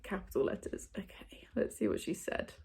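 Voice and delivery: hushed unvoiced whisper, like sharing a secret; conspiratorial whisper before opening the email. Real, not acted. Secretive reading of hidden email.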